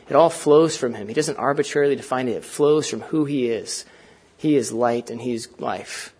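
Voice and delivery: ordinary delivery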